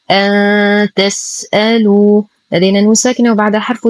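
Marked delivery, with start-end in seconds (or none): 0.1-2.2 slow أن تسألوا, ن hidden in a long thin hum before ت; light ikhfa. Shown as a demonstration. Teacher shows noon before taa, light full ghunnah. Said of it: "إخفاء حقيقي مع غنة كاملة مرققة".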